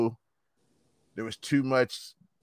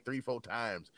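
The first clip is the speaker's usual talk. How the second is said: ordinary talk, nothing marked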